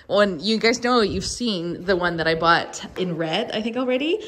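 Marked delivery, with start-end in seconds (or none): none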